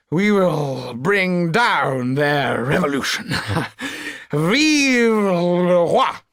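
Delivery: put-on high, slow drunken threats with a laugh; a drunk royalist sneering into the butler's face; drunken spitting fury. A narrator's reading. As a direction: spit it at the rabble, drunk and gloating. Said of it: "He spat the words in Jacques' face".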